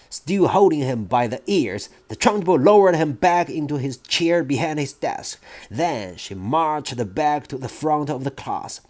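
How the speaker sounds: usual speaking voice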